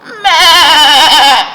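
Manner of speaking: high loud meh with a laugh; better goat bleat. Shown as a demonstration. Storyteller's second try at Goat's bleat. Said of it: "That's better".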